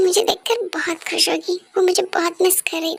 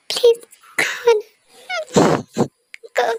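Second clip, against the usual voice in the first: ordinary talk, nothing marked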